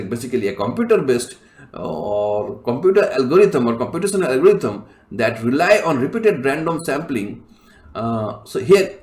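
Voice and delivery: normal talking voice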